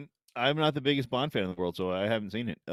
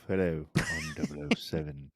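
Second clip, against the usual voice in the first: James Bond; 6 semitones lower, 4 dB quieter than usual. Acted. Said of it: "I could pull it off".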